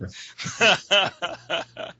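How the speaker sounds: long amused laugh; real laugh. Real, not acted. Guest teased as a Paulo Silvino lookalike, laughing. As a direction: laugh along at being teased.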